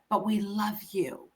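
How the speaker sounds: soft, slow, tender; gentle softened reassurance. Retold or imitated. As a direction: say it gently to your daughter. Parents' loving reassurance, retold.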